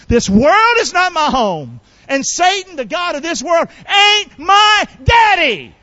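high, loud, defiant shout, slowing at the end; rally the congregation, hammering out the last words; rallying preacher's shout. Shown as a demonstration. One more time, rallying the congregation.

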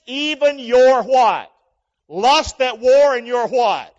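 loud, high, slow preaching; fiery preaching. Acted. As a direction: thunder the scripture. Preaching scripture at full force.

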